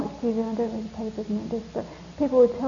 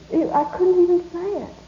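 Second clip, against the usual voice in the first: ordinary delivery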